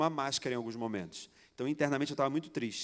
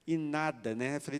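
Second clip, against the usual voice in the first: ordinary talk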